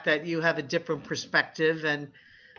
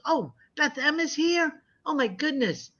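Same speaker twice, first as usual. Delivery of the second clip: delighted surprise; 8 semitones higher than usual. Real, not acted. Greeting a viewer who arrived.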